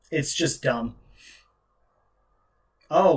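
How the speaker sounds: ordinary talk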